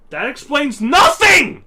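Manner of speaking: loud exasperated outburst; exasperated shout. Real, not acted. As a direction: yell it in disbelief. Exasperated rant about Godzilla.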